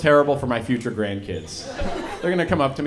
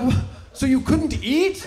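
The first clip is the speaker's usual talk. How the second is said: higher, slow, confused question; puzzled grandkid; 12 semitones higher, 4 dB louder, slower than usual. Acted. Grandkid confused by Papa, high slow puzzled. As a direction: ask like a baffled child.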